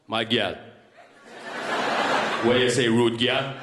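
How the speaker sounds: hammed-up Jamaican chat-up voice; mock Jamaican accent. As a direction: put on a Jamaican accent to flirt. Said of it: "I pretend to be Jamaican".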